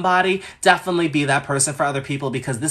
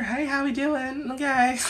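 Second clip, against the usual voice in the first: a much higher, slow, soft singsong hello; odd high greeting; 11 semitones higher, 4 dB quieter, slower than usual. Real, not acted. Greeting everyone in the stream in a high, slow singsong. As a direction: stretch the greeting up high and slow, half joking. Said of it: "I don't know what that was".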